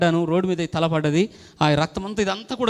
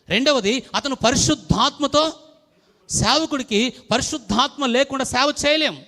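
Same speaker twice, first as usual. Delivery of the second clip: preaching; 5 semitones higher than usual. Acted. Preaching on Stephen.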